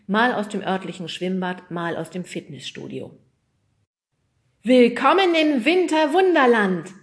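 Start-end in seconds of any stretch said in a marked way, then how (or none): 4.6-6.9 loud, high showman's welcome; big swooping announcer's shout; called out. Acted. Weatherman welcomes viewers to winter wonderland, calling out. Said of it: "rief Hupsi".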